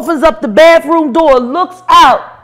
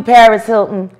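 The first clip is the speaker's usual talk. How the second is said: ordinary talk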